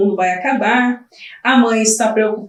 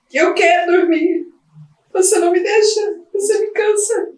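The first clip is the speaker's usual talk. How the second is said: higher, crying plea from an exhausted mother; breaking down in tears; 8 semitones higher than usual. Acted. Sleepless mother begging her baby to sleep, crying. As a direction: let the voice crack and climb into sobs.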